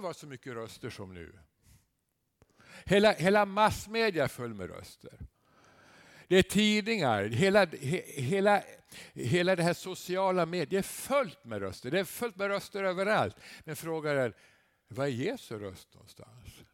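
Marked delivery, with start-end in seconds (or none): 2.8-13.4 rising fervour. Real, not acted. Preacher grows heated about media voices.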